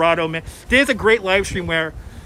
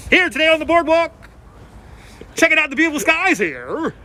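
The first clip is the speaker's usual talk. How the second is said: radio weather report; 6 semitones higher than usual. Shown as a demonstration. Asked for his radio voice, he plays a radio weatherman. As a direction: stretch every tiny detail like an on-air DJ. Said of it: "being a radio guy, you gotta make the small things last forever".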